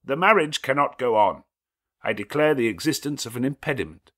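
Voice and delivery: a stranger halting the wedding; stranger's interruption. A narrator's reading. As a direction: cut the ceremony off firmly. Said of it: "a distinct and near voice said".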